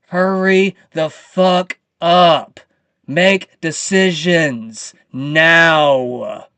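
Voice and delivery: loud, slow, furious demands; angry slow yelling. Real, not acted. Ranting vlogger yells demands at the government. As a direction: drag each word out in rage. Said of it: "I'm getting way too pumped today".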